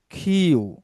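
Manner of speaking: "খিউ" with a breathy kh. Shown as a demonstration. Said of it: "আজকে থেকে বলবো আমরা খিউ". ("খিউ" স্পষ্ট)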